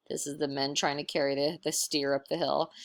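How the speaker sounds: plain reading voice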